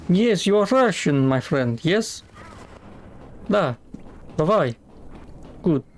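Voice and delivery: high, hammed-up mock Russian accent. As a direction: play a jokey Russian friend in a Russian accent. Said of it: "Russian accent".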